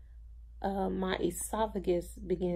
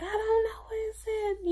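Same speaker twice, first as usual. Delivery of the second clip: much higher, strained mock yell; retold yell. Daughter yelling at mom while lost outside the hospital, retold high-pitched yell. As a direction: re-enact snapping at mom in a high-pitched cry. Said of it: "I yelled at my mom".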